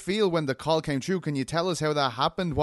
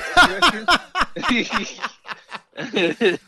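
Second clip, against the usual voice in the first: a big real burst of laughter, higher and louder; 6 semitones higher, 7 dB louder than usual. Real, not acted. Host laughing at the fighter's cheeky joke, loud laugh. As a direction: crack up out loud.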